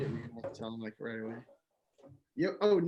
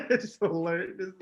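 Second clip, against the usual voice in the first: laughing; 9 semitones higher, 7 dB louder than usual. Real, not acted. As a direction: laugh the words out. Mocking easy code.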